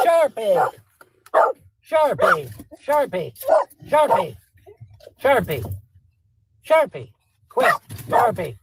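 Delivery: high, sharp repeated commands; scolding a dog. Real, not acted. Owner ordering barking dog Sharpie to quit, scolding. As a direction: call out short, high-pitched orders.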